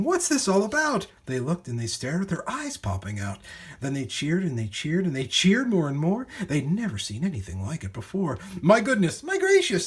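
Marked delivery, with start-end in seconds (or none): 0.0-1.1 high, loud amazed question; excited shout. A narrator's reading. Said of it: "the people came shouting". 8.6-9.9 very high, loud cries of wonder; amazed shout. A narrator's reading. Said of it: "they shouted".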